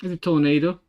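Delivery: Tornado with a stressed AY in the middle; English-style Tornado. Shown as a demonstration. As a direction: say Tornado as tor-NAY-do, the English way. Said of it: "I guess that's how people would pronounce it in English".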